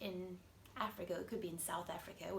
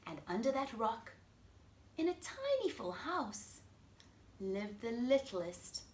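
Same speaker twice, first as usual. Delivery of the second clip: put-on lively storybook voice for kids; reading to children; 5 semitones higher, 11 dB louder than usual. A narrator's reading. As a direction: read it like bedtime story time. Reads picture book to children.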